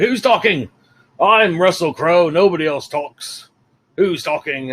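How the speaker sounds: grand, hammed-up indignation; Russell Crowe as Zeus. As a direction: demand it like a preening god. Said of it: "it's Russell Crowe loving himself".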